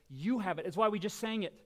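ordinary delivery

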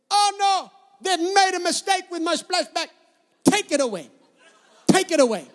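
put-on loud Bulgarian-accented horror, starting high and dropping to a command; mock Bulgarian accent, loud and dramatic. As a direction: imitate an outraged Bulgarian wife. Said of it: "in her little Bulgarian accent".

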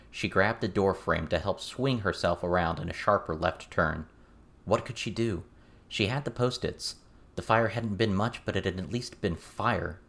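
ordinary delivery, nothing marked

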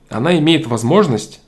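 usual speaking voice

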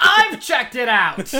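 loud, high, drawn-out protest; indignant shout. Real, not acted. Podcaster insisting they did check it out, indignant shout. Mock outrage at friend's admission.

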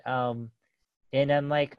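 ordinary talk, nothing marked

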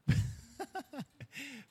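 high-pitched laugh; a burst of real laughter. Real, not acted. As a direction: break into a high, light laugh. Chuckles before quoting an old saying.

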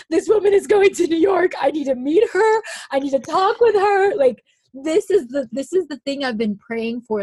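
loud, high, thrilled exclaiming; breathlessly eager, voice shooting up loud; excited outburst. Retold or imitated. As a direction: relive the excitement at full pitch. Retells her thrilled reaction to the news.

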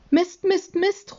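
high-pitched exclamation of frustration; frustrated boy calling out; exasperated cry. A narrator's reading. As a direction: cry it out high, word after word. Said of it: "ruft Jonathan".

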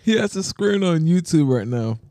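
real laughter breaking up the sentence; laughing. Real, not acted. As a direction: try to explain while cracking up. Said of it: "I'm laughing".

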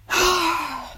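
long, voiced falling sigh. Real, not acted. Vlogger pausing in a busy day, long sigh. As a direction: let out a big drawn-out sigh. Pause while tidying her room.

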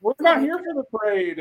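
high-pitched mock protest to the crowd; retold protest. Men mistaken for a parade answer the kids, protesting. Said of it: "And we're like".

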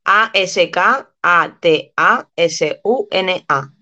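slow letter by letter; spelling out. Shown as a demonstration. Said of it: "deletréamelo". (deletrea letra por letra despacio)

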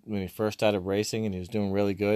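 ordinary delivery

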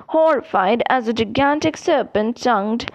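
plain reading voice

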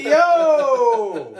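loud high shout of delight. Retold or imitated. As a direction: yell it at the TV, thrilled. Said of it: "And I'm like".

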